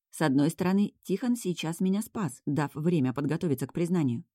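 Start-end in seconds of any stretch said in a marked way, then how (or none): none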